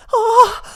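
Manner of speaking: high piercing scream of shock; little fairy shrieking in horror; shocked cry. Acted. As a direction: shriek at the brown strawberries. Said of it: "ruft das Erdbeerindli".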